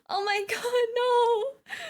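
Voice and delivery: high-pitched wail through laughter; amused outcry. Real, not acted. Reacting to game character's tears.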